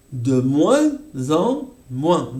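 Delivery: "De moins en moins" spelled out slowly, nasal vowels; slow each nasal in "De moins en moins"; slow demo. Shown as a demonstration. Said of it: "revisión fonética". ("De moins en moins" muy lento)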